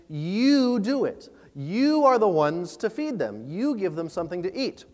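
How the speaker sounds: every "You" punched, "You do it" slow and drawn out; Jesus insisting "You" must feed them; stress on YOU. Acted. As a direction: point at the disciples with each "You". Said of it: "the you is emphasized".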